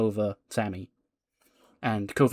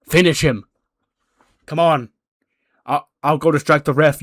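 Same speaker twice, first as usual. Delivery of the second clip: louder, pitched-up push to finish it; urgent urging; 3 semitones higher, 6 dB louder than usual. Retold or imitated. Woods urging Kofi to finish Sami, urgent and louder. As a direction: hype him up to go for the kill.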